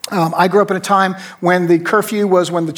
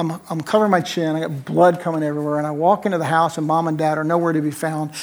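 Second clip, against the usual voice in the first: ordinary talk, nothing marked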